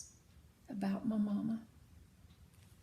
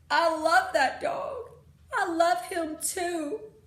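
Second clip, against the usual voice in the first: louder, higher, slow tearful confession; crying; 7 semitones higher, 10 dB louder, slower than usual. Acted. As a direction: sob out your love. Preacher crying about the dog.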